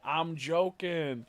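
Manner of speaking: loud, long drawn-out "joking" catchphrase; big hammed-up backpedal; imitating sketch professor. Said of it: "he goes".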